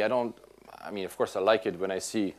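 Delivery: usual speaking voice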